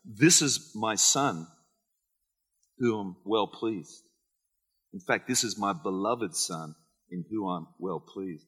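voice from heaven. Retold or imitated. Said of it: "A voice came out of heaven saying".

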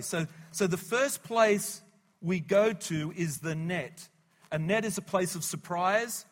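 loud, driving sermon delivery; preaching at full force. Real, not acted. Preaching builds.

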